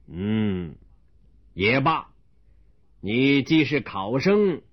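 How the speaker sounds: low, slow official drawl; an old magistrate laying down a test; deep deliberate magistrate voice; stern county magistrate. Acted. Magistrate voices his challenge.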